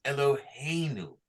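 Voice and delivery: teacher showing Eloheinu's accent; correct stress. Shown as a demonstration. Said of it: "that's where the accent is".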